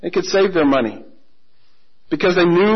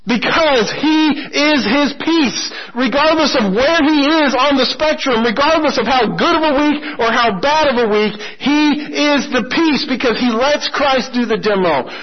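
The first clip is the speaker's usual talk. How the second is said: higher, building to a close; climactic preaching; 7 semitones higher than usual. Acted. Preacher building to the sermon's climax, impassioned. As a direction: preach it with rising passion.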